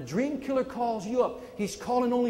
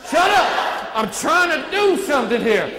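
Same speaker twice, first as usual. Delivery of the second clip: loud, high shut-up; triumphant loud shut up; defiant shout; 7 semitones higher, 9 dB louder, slower than usual. Retold or imitated. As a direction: shout down the doubter. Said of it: "And what did I say?".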